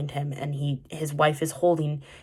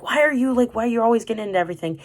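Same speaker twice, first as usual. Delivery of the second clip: imitating a scolding mom, higher and rushed; mock angry mom; 6 semitones higher, much faster than usual. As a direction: snap at the child in a hammed-up scold. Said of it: "She got mad at him".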